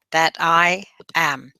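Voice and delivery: slow, weighted "I am" to finish; stress on "I am". Shown as a demonstration. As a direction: draw out "I am" with emphasis. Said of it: "put the emphasis on the "I am".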